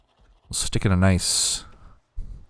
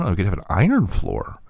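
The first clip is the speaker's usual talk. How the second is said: usual speaking voice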